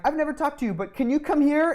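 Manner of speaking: high-pitched mock teacher summons; adult addressing child. Adult to a child they never met, high put-on request. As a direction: pitch it up like an adult beckoning a kid.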